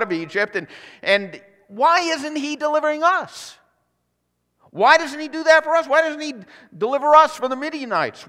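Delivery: indignant complaint. Acted. Gideon complaining to God about deliverance, high indignant cry. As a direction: cry out that it's unfair.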